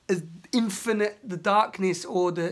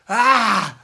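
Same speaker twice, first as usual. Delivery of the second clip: ah; 4 semitones higher, 10 dB louder than usual. Real, not acted. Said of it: "in the expression right now".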